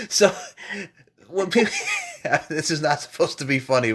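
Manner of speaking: high, real laughter breaking in; trying not to laugh. Real, not acted. Host starting a serious topic, cracking up. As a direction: fight the giggles while talking. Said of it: "try to say this with a straight face".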